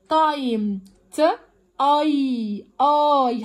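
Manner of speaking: تايم, then ت and a held اي twice; clear long I. Shown as a demonstration. Teacher proving the I is heard clearly, sounded out. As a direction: isolate اي as a long, clear "eye". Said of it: "بيظهر صوت حرف الاي واضح".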